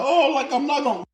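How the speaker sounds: high mocking whiny voice; mock crying whine. Mocking people who cry online in a high whine. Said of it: "on the internet crying like".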